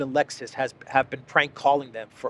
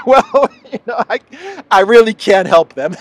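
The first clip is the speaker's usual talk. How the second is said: amused; 6 semitones higher, 8 dB louder than usual. Real, not acted. Laughing, amused helplessness.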